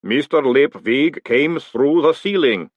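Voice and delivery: slow, deliberate golem voice; ponderous golem. A narrator's reading. Pump explaining to Groat, ponderous. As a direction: state it word by slow word.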